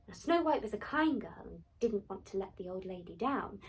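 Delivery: plain reading voice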